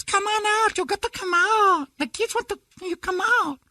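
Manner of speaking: very high falsetto wife coaxing. Retold or imitated. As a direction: coax him out in a high woman's voice. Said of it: "So his wife goes".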